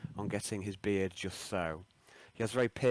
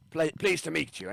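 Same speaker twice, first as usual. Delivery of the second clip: Scottish greeting; 6 semitones higher, 3 dB louder than usual. Acted. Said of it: "so he says".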